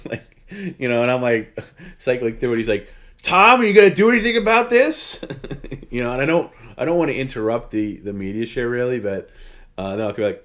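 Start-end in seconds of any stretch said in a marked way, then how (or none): 3.2-5.2 high, loud imitated yelling at Tom; mock yell. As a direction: shout it like an impatient co-host. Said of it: "when he's yelling at me".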